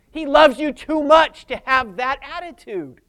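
ordinary talk, nothing marked

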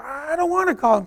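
high, reluctant mock whine; whiny protest. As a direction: balk like a nagged kid.

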